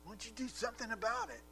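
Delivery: a sister's put-upon, needling complaint; condescending. Acted. Martha complaining to Jesus, condescending and reproachful. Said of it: "condescending tone in her voice".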